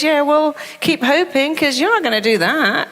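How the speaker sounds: lightly voiced mocking inner critic; mocking mind voice. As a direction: taunt the hopeful speaker. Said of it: "it goes".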